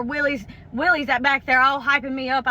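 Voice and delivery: ordinary talk, nothing marked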